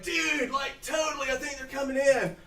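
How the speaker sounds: high dude shout; surfer-dude Pog. Acted. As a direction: Pog announcing the caravan. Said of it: "He's like".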